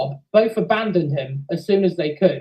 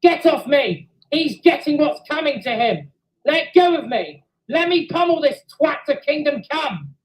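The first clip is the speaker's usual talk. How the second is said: enraged engine shouting while held back; furious shouting; 7 semitones higher than usual. A narrator's reading. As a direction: struggle against the hold and yell. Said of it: "continued to shout".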